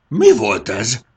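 loud, alarmed outburst; a dragon mother's startled loud cry; startled roar. Acted. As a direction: bellow it in sudden alarm. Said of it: "hördült fel sárkánymama".

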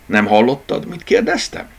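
gruff growled question, rising at the end; grumbling dachshund. A narrator's reading. Dachshund growls at the rabbit, gruff and impatient. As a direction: snap at the newcomer. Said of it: "morrontott rá Zsutek".

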